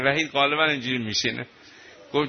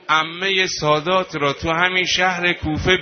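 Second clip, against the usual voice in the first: louder and louder wailing lament; building wail; 4 semitones higher, 4 dB louder than usual. Real, not acted. Preacher wailing to mourners over the captive women. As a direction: push it up to a crying cry.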